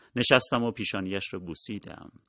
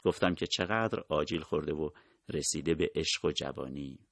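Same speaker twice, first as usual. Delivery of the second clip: ordinary delivery, nothing marked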